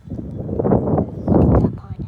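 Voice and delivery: slow, loud, breathy call; loud drawn-out exclaim. Real, not acted. Repeated louder and drawn out.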